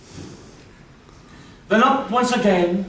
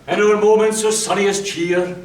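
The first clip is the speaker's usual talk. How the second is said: ordinary delivery, nothing marked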